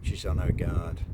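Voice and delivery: plain reading voice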